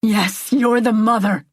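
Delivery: exasperated, restrained shout; yell. A narrator's reading. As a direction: yell it back in agreement. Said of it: "he yells".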